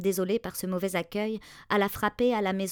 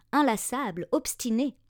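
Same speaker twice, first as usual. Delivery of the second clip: ordinary delivery, nothing marked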